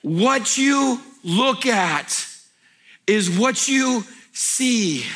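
very slow, each word spaced, "you" stressed; very slow repeat. Acted. Pastor repeating his maxim for the crowd, very slow. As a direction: spell it out very slowly, stressing "you". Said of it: "most profound things I've ever said".

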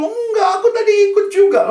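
very high, loud wailing; mock sobbing child. Weeping pupil answers her teacher, mock sobbing. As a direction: wail like a crying schoolgirl. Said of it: "dia nangis terseduh-seduh".

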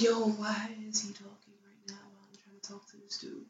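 unvoiced whisper of annoyance; whispered aside. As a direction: hiss it under your breath. Inner thought, annoyed aside.

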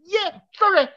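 sudden high squeaky apology; a real screech that flies up high. Real, not acted. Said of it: "That was a screecher".

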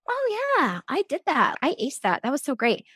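casually proud of yourself, lightly hammed-up; breezy self-congratulation. Mother looking back on early breastfeeding, breezy mock pride.

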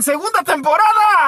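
loud, high hype yell; mock-triumphant announcement; excited hype. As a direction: shout the season announcement like a hype man. Hyping second season.

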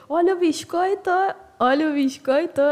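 high, loud singsong hawker cry; singsong beach vendor call. Retold or imitated. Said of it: "o monstro que é você".

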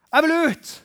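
high, loud eager cry; eager outburst. Acted. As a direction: burst out wanting to go. Acting the eager evangelist.

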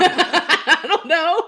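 slow, laughing reply. Real, not acted. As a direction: say it through laughter. Laughing at the Who joke.

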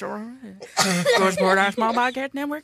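rapid, slurred mumbling run-on voice; Boomhauer. Acted. Host reading the network plug as fast-mumbling Boomhauer. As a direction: rattle it off as an almost unintelligible mumble. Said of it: "[name] does Boomenhauer".

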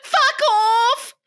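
very high, loud hammed-up yell; mock shriek. Hermione parody yelling at the door, high and loud. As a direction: spit it out like a shrieking teen.

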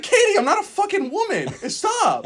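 high, loud mock outrage; flustered protest. Telling Katie he's not a woman, high loud protest. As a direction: squeal it in comic protest.

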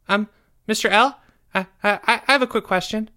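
put-on high-pitched, stammering little boy voice; hesitant schoolboy. A narrator's reading. Pupil tugging teacher's sleeve, hesitant high voice.

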